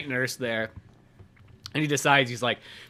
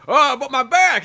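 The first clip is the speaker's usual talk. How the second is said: put-on whiny old dad; 10 semitones higher, 7 dB louder, slower than usual.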